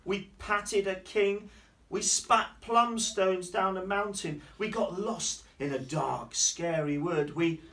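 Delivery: put-on loud, slow, excited child recounting; Lulu. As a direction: list adventures with big drawn-out emphasis. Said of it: "Lulu's telling Daddy what happened".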